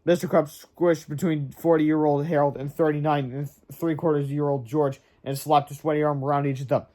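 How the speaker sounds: plain reading voice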